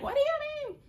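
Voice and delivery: hammed-up outraged listener; mock objector. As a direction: caricature a shocked critic. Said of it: "the people gonna be like".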